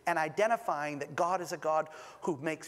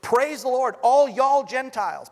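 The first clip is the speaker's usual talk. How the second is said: put-on joking Southern accent, raised and loud; Southern twang; 7 semitones higher, 11 dB louder than usual. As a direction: ham up a Southern preacher saying y'all. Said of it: "If he was a translator from the south".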